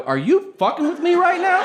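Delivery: high, loud disbelief; incredulous. Retold or imitated. Comic to Bruce Bruce on a plane, high incredulous yelp.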